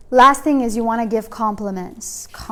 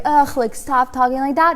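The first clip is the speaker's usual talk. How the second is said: mock annoyed groan; 4 semitones higher, 5 dB louder than usual. Husband pretending to be annoyed.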